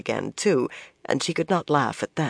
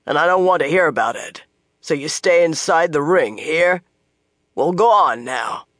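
firm gruff soldier; 4 dB louder than usual. Acted. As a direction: lay down the law to the girls. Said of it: "in a firmer tone".

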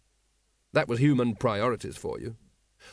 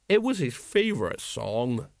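put-on slow, mournful drunken sergeant; a touch of tipsy sorrow; sad. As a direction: grieve over the words. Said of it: "said Colon sadly".

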